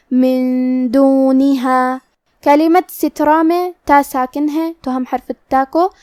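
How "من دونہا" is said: من دونہا very slow, ن hidden with nasal hum; ikhfa demo. Shown as a demonstration. Teacher modelling ikhfa on من دونہا, very slow. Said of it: "غنہ کے ساتھ ادا کریں گے".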